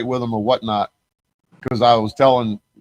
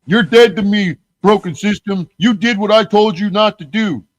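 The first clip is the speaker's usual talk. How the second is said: high, louder mock of Flax; higher, louder imitation of an angry Flax; 8 semitones higher, 4 dB louder than usual. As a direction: squeal it out like a petty rival. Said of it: "I got video of Flax saying".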